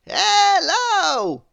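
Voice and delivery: loud, high greeting; booming cheerful wizard; jolly wizard. Acted. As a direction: sing out the hello. Wizard voiced in story.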